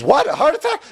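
high, loud disbelieving question; shocked question. Acted. Patient learning of his heart attack, shocked. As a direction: blurt it out in shock.

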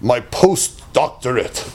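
a hint of a low, slow self-satisfied boast; lightly voiced smug answer. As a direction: list your degrees, drawing it out. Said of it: "So, I would say".

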